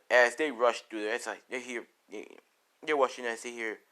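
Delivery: usual speaking voice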